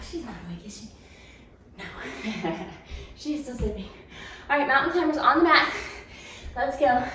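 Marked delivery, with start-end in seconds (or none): 0.1-3.9 much softer, hushed so as not to wake her; hushed aside. Real, not acted. Trainer hushing about a sleeping girl, softly. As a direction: drop to a hush mid-workout.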